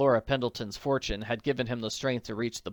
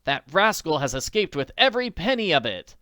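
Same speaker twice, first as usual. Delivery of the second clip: louder, higher indignant outburst; angry; 5 semitones higher, 6 dB louder than usual. A narrator's reading. Nancy denounces the thief, loud and indignant. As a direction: rail at the thief, loud and high. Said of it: "she cried angrily".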